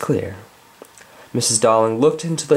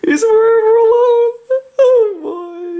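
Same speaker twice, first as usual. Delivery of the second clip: mock wail; 5 dB louder than usual. Mock lament for the character.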